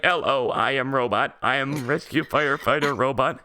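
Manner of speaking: low, flat toy robot voice. Retold or imitated. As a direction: speak like a stiff toy robot. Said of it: "That's a good robot voice".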